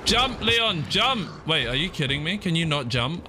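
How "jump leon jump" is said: high-pitched frantic urging; urgent command. Real, not acted. Gamer telling Leon to jump, high urgent command. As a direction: urge the jump in a high, pressing voice.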